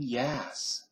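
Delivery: "Yes" said as "yas"; LA-accent yes. Shown as a demonstration. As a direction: open the vowel of "Yes" to a short A. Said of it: "A sound like yas".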